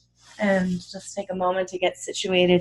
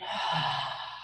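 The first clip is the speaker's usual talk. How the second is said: soft, low sigh out; relaxed release of breath; exhaling sigh; 6 semitones lower, 10 dB quieter than usual. Shown as a demonstration. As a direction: let the breath go in a quiet sigh. Breath exercise, exhaling with the shoulder drop.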